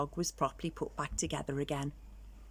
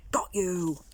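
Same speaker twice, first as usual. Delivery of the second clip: pounced spooky gotcha; spooky pounce. Acted. Skeleton catching friend, playful spooky pounce. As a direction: snap it like catching prey.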